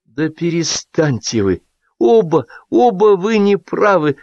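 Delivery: high, drawn-out scolding; exasperated intervention. A narrator's reading. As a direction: step in and stop both of them. Woman breaks up a quarrel.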